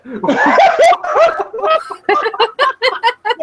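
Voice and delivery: high wheezing laugh, cracking up; helpless high laughter; loud burst of laughter. Real, not acted. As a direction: lose it completely at the memory. Big laugh at story.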